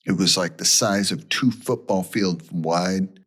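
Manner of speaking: ordinary talk, nothing marked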